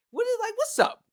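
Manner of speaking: very high, loud exasperated question; high-pitched baffled protest; incredulous. Real, not acted. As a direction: shoot way up high in disbelief. Baffled outrage at the stereotype.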